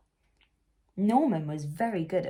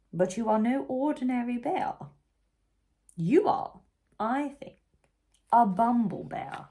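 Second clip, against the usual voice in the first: lightly voiced proud queen, louder; queen's award; 5 semitones higher, 4 dB louder than usual. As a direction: bestow the honour proudly. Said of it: "said the Queen".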